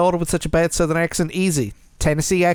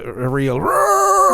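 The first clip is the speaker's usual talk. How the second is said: a growling roar, very high and slow; mock roar; 14 semitones higher, 3 dB louder, much slower than usual. Host mocking the macho film with a drawn-out roar. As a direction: roar it out like a hammed-up macho beast.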